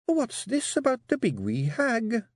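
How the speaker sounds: high, slow Scottish wife, deceptively meek; small, meek voice. Acted. Wife questions husband about the hag, dangerously meek. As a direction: ask it small and slow, with menace beneath. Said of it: "her voice as small and meek as a mouse trained at the Rodent College".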